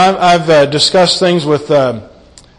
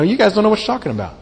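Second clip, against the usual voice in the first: dismissive intimidation; 4 semitones higher, 5 dB quieter, much faster than usual. Retold or imitated. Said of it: "an intimidation here".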